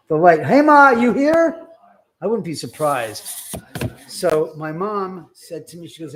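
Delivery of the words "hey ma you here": high loud call to a ghost, slow; joking call to his dead mother, higher and louder. Pretend.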